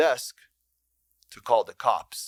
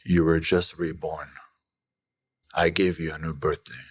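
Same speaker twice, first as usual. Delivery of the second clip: usual speaking voice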